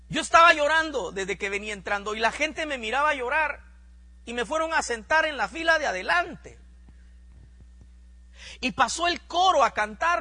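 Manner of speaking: fervent preaching. Acted. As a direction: drive the testimony up with fire. Preaching testimony at full force. (predicación fervorosa, más alta y fuerte)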